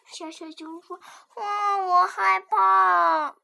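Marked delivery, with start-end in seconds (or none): 1.4-3.4 slow loud frightened cry; scared wail. Acted. Cub cries out in fear.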